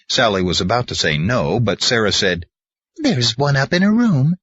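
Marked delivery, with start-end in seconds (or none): 3.0-4.3 grandma voice. Acted. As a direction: voice her like a sweet grandma. Sarah voiced.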